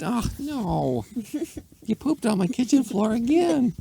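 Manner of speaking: very high squeaky lament; exasperated lady. A narrator's reading. Lady finding poop on her kitchen floor, high exasperated whine. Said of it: "She said".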